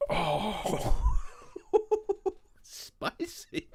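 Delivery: high wail breaking into squeaky laughter; pained laughing wail. Real, not acted. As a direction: squeal through stinging eyes. Eyes burning from vapor rub.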